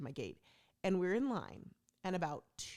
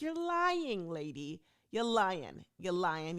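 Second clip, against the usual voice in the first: mock accusation; 5 dB louder, much slower than usual. Retold accusation at line cutter.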